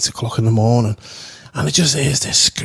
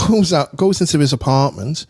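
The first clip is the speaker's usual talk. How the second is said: ordinary talk, nothing marked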